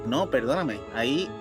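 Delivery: normal talking voice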